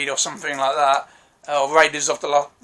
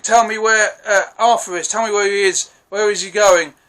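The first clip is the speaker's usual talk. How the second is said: higher and louder, demanding answers; mock interrogation shout; 5 semitones higher, 5 dB louder than usual. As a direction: bark the question at the man over the cliff. Mark dangles man off cliff.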